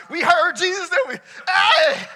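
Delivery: high hammed-up joyful shout, laughing; mock shout. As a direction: burst out in a laughing holler.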